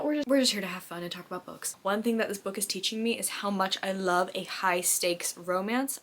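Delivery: ordinary talk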